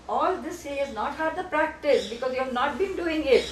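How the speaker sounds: ordinary talk, nothing marked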